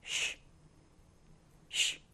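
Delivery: 쉬 as a soft, unvoiced "sh" hush; "sh" sound demo. Shown as a demonstration. Teacher demonstrates the sh sound, 쉬 hushed without voice. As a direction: say 쉬 as a bare "sh", no vowel. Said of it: "이 에스에이치 발음은".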